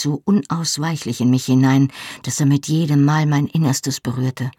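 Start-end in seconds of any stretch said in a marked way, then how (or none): none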